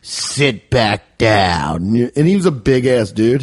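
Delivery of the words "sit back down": very slow, low order; stern teacher. Retold or imitated. Teacher ordering a student back to his seat, slow and low. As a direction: draw each word out low. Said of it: "He'd be like".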